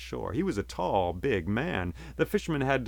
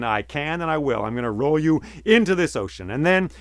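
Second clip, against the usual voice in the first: stern threat; 5 semitones higher, 7 dB louder than usual. A narrator's reading. Fisherman threatens the genie.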